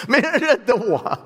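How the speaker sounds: amused self-mocking aside; laughing. Real, not acted. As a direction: laugh it off at your own expense. Pastor jokes nobody recognized him.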